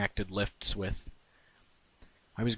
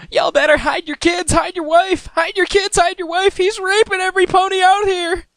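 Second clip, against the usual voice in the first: very high, loud cartoon pony shriek; panicked pony screaming to Mister Cake; Pinkie Pie shouting; 13 dB louder than usual. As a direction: holler the warning like a hysterical cartoon pony. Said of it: "she replied".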